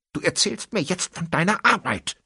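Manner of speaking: high, sharp demand; a sudden sharp, high-pitched demand. A narrator's reading. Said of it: "wie scharf ihr Tonfall war".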